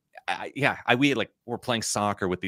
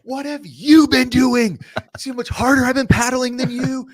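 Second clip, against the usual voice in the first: hammed-up indignant shouting; retold screaming match; 8 dB louder than usual. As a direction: act out yelling back at your friend. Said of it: "we're just screaming at each other".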